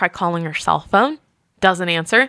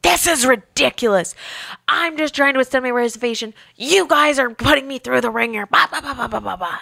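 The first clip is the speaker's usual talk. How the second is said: angry guest; 5 semitones higher than usual. Retold or imitated. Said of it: "She's like".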